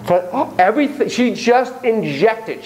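ordinary delivery

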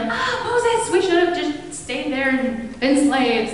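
high whining mock complaint; mock whine at Moses; whiny Israelites. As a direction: whine at Moses like a grumbling crowd. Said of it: "they're like".

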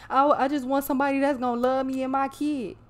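mocking the ex's sudden neediness; mock plea. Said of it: "Now all of a sudden".

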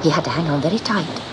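plain reading voice

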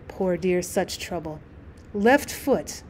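low, softened reading murmur; soft murmur. A narrator's reading. Woman murmuring sympathy over a letter from Lottie, soft murmur. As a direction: read it softly under the breath, dropping away. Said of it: "came her soft murmur".

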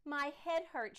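moaning. Acted. Sarah to her father in the kitchen, moaning. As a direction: whine about the headache. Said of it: "she moaned".

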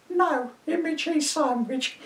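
put-on very high, slow old woman; squeaky old dear setting him straight; old lady correcting the policeman; crone voice. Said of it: "my crone voice".

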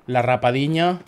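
ordinary delivery

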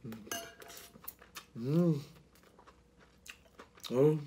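mumbling while chewing; closed-mouth murmur with food; mouth full, chewing. Real, not acted. Seen 0: Spoonful eaten at -3.0; closed mouth, cheeks working through labelled words.

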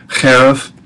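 "Cherev" को गले से खुरदरी ch ध्वनि के साथ बोलें; throaty ch sound. Shown as a demonstration. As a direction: start "Cherev" with a rasping throat ch. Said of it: "Don't forget your ch sound at the chetz".

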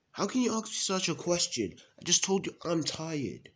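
high-pitched, affronted retort; indignant. Acted. Telling off the one who doubts the tiredness, indignant. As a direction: protest in a high, offended voice.

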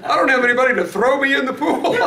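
loud, high-pitched comic complaint; hammed-up indignant quote; mock exasperated quote. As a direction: protest it for laughs, high and loud. Hilarious literal quote, audience laughing.

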